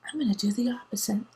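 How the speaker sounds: fast unvoiced whisper; quick conspiratorial whisper. Real, not acted. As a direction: whisper it like a secret. Secret resolve.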